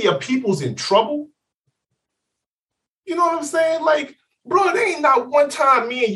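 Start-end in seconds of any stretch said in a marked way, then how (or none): 3.1-6.1 raised, higher call to a friend; real emphatic appeal to a friend; emphatic plea. Real, not acted. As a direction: press the point hard at him.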